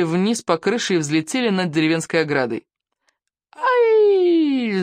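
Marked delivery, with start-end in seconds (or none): 3.5-4.8 high loud yelp; yelp in flight. A narrator's reading. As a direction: shriek while flying through the air. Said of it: "завопили Икинг и Камикадзе". (громкий вскрик)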